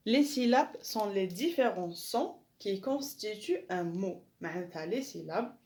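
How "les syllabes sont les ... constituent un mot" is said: slow, deliberate French read-out; slow dictation. Shown as a demonstration. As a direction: spell it out slowly for pupils to copy. Teacher dictates French rule.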